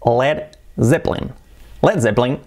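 "Led Zeppelin" as led ZEP-lin, buzzing Z; native English pronunciation. Shown as a demonstration. Teacher revealing how a native would say it, English pronunciation. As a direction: squeeze "Zeppelin" into two English syllables. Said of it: "Fijaos que diría".

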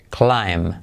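"climb" dicho claim, sin b final; "climb": la i como ai y la b callada; b muda. Shown as a demonstration. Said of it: "pero no se pronuncia la B". ("climb" pronunciado claim, sin b)

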